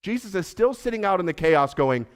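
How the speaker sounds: ordinary talk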